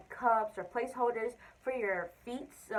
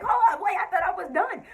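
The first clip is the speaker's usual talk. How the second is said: high, loud, laughing alarm; startled laughing protest; 9 semitones higher, 8 dB louder than usual. Retold or imitated. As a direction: yelp it out through a laugh. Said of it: "I'm like".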